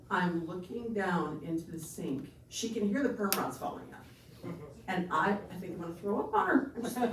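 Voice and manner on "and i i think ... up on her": normal talking voice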